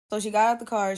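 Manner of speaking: ordinary talk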